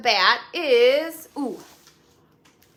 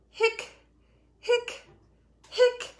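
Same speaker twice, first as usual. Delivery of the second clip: hiccuping; 11 semitones higher, much slower than usual. Acted. Voicing skeleton's hiccups.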